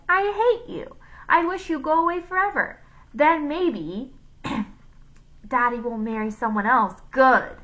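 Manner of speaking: put-on high, loud, hateful child outburst; Joe. A narrator's reading. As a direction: mimic a bratty boy raging at his mother. Villain poses as Joe on phone.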